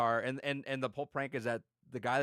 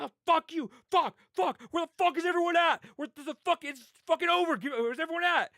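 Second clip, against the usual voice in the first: very high, loud frantic yelling; acted panicked yelling; 7 dB louder than usual. Victim forced into trunk looking for everyone, panicked shouting. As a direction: yell out in mock panic for help.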